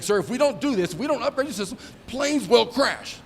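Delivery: a mock-grave junior officer warning his commander; overly earnest lieutenant. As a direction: sell the dire warning like an eager young officer. Imitating Owen's dire briefing.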